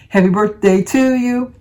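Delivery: usual speaking voice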